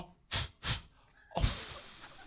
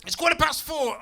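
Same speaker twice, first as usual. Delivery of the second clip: high, loud demanding wife; snappy wife; 12 semitones higher, 6 dB louder than usual. Retold or imitated. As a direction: scold him like a woken wife. Wife correcting husband.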